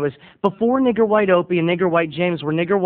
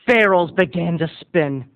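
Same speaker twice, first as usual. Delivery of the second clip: ordinary delivery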